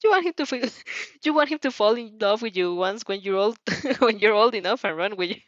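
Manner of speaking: ordinary talk, nothing marked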